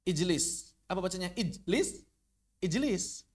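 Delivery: ijlis with the j released in a bounce; qalqalah drill. Shown as a demonstration. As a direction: ijlis with a ringing j. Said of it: "Coba baca ijlis tanpa qalqalah".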